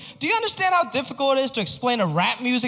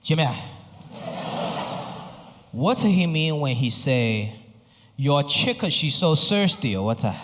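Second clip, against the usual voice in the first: put-on light Chinese dad accent; 5 semitones lower than usual. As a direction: ask like a puzzled immigrant dad. Dad asking about lyrics.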